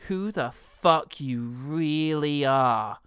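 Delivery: slow, drawn-out line; slow menace. Retold or imitated. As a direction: stretch each word. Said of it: "somebody says".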